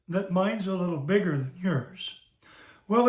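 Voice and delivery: ordinary delivery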